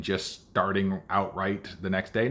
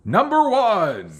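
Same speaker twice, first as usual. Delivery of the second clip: booming slow announcer call; fighting game announcer; 11 semitones higher, 7 dB louder, slower than usual. Pretend. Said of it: "Can you do the announcer voice?".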